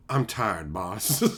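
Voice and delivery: very low, soft film-quote voice; deep, quiet, tired confession; mock weary man. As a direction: say it low and worn out. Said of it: "it could just be like".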